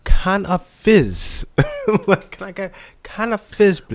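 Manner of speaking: lightly put-on British customer; mock British order. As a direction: ask for fizz like a Brit at a counter. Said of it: "they gonna be like".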